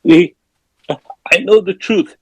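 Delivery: high, halting mock fright; retold scared confession. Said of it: "like, all scared".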